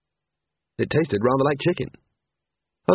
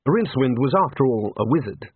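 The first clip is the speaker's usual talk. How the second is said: ordinary delivery, nothing marked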